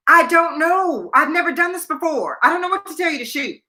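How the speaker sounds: flustered protest. Retold or imitated. Rookie reporter pressed by photographer for a shot, flustered.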